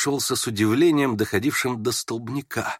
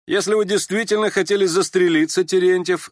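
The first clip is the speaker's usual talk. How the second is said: high, loud taunting address; amused mockery; 9 semitones higher, 5 dB louder than usual. A narrator's reading. Yevgeny Pavlovich laughing at the would-be suicide, mocking. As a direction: tease him with amused lightness. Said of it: "засмеялся Евгений Павлович".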